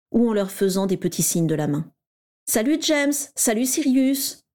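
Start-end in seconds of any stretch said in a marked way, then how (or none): 2.5-4.4 higher, slow, simpering greeting; simpering girls. A narrator's reading. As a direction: coo their names to get noticed. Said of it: "minaudèrent certaines d'entre elles".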